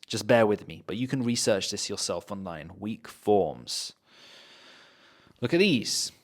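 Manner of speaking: normal talking voice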